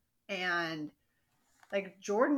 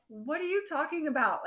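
higher, puzzled disbelief; mock disbelieving Portia; incredulous; 7 semitones higher than usual. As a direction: question her as if she's crazy. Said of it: "And she's like".

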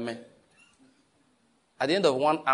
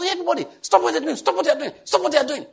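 mock panic, much higher and louder, with a shrill woo; panicking voice; 13 semitones higher, 4 dB louder, faster than usual. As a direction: imitate the consultant's frantic alarm. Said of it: "He came with a panicking voice".

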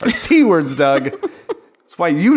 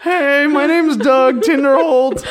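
goofy character voice; 9 semitones higher, 4 dB louder, slower than usual. Pretend. Voicing Doug character.

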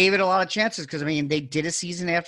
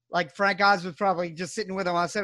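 ordinary talk, nothing marked

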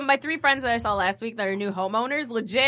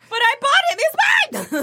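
squeaky whine, much higher; high whiny protest; 13 semitones higher, much faster than usual. Retold or imitated. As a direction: whine it like a kid.

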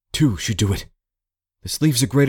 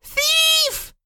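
very high-pitched shriek of alarm; shrieking cry. A narrator's reading. Woman cries out at a thief, shrieking. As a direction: shriek it across to other buildings. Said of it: "shrieking from one of the other buildings".

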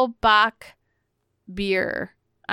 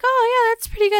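pleasantly surprised; 14 semitones higher, 4 dB louder, much faster than usual. Retold or imitated. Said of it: "I'm like".

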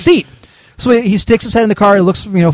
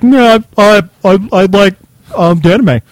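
hammed-up nerdy fan voice, louder; mock the fans who mumbled about anime; sheepish fan mumble, lightly mocked; 4 dB louder than usual. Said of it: "Everybody was like".

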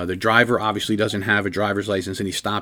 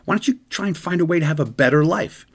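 lecturing cop; 6 semitones higher than usual. Retold or imitated. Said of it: "they will say to somebody".

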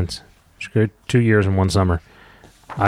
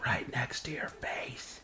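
breathy whisper, slow, right into the mic; slow teasing whisper in the kid's ear; joking whisper; 5 semitones higher, 11 dB quieter, much slower than usual. Acted. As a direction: tease the reader by whispering close. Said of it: "Can you please stop doing that?".

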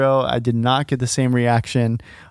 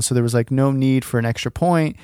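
ordinary delivery

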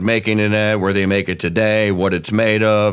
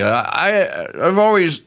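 normal talking voice

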